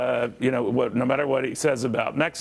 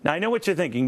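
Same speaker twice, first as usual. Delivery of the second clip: ordinary delivery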